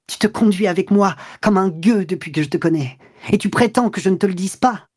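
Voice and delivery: high-pitched, building outrage; indignant revolt. A narrator's reading. Woman revolting against her lover's indignation, indignant and high. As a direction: throw the accusations at him, pitch climbing. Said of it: "Elle se révolta contre cette indignation".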